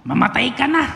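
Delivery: high, loud squawking parrot line. Retold or imitated. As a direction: screech it like a rude parrot. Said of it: "Ang sabi po ng parrot". (matinis at malakas na boses ng loro)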